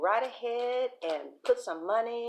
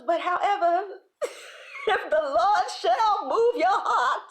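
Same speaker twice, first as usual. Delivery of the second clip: put-on pious old aunt; 11 semitones higher, 8 dB louder than usual. Aunt hinting she'll take the money, hammed-up pious plea. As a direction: proclaim it grandly, voice wobbling with laughter. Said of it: "She goes".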